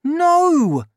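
put-on high loud boy's cry of protest; shocked boy cries out; Danny crying out in alarm. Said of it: "I cried".

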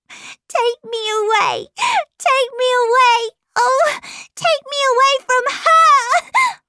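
put-on tearful child's shriek, very high and loud; angry cry. A narrator's reading. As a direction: wail furiously to be rescued. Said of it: "there was an angry cry".

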